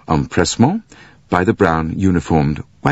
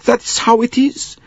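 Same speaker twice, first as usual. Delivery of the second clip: put-on high, helpless accented official; respectful concierge. A narrator's reading. Concierge explains to the director, high and respectful. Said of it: "turning to him respectfully".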